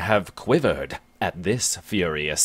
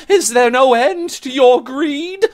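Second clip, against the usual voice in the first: very high, louder shocked gasp of words; 14 semitones higher, 7 dB louder than usual. Acted. Bennet shocked at the giant's greed: high appalled exclamation. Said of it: "she says".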